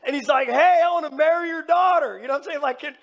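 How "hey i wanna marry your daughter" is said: mock bold shout. Suitor asking father for daughter.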